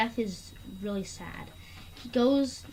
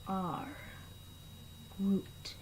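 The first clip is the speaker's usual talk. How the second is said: slow, lower words with long pauses between them; solemn Groot; 3 semitones lower, 9 dB quieter, much slower than usual. Retold or imitated. Groot shielding his friends, solemn and slow. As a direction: lay each word down slowly and gravely. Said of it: "And he's like".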